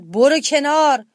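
slow, loud roaring command; lion roaring. A narrator's reading. Lion ordering the hero aside, roaring. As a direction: roar it out as an order. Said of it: "شیر نعره زد".

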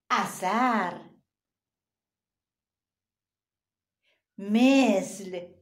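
اثر and مثل stretched very slowly; slow syllable reading. Shown as a demonstration. Teacher reads board words slowly to children. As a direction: read each word slowly for kids.

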